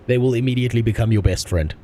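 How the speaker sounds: ordinary delivery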